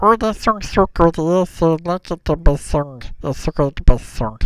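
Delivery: high-pitched, garbled monkey voice; Bob. Acted. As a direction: cheer like a little Stitch-like creature. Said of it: "And he'd be like".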